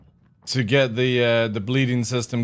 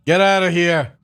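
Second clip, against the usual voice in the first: shout; 4 semitones higher, 5 dB louder than usual. Acted. Chasing player away.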